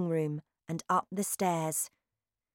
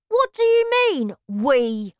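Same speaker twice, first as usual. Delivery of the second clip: put-on high, loud boyish voice; a greedy boy talking with his mouth busy; Bruno's voice; 8 semitones higher, 8 dB louder than usual. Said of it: "he asked".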